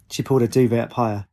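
usual speaking voice

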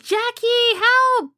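high, mock-desperate child's yell for his brother; imitating a boy calling his brother for help. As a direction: cry out like a scared kid in the corn. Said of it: "It's like".

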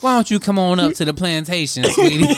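flirty Southern belle, pitch lifting on the last word; Southern plantation accent. Acted. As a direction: sweet-talk like a Southern plantation mistress. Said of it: "W accent".

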